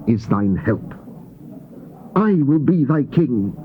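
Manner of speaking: ordinary talk, nothing marked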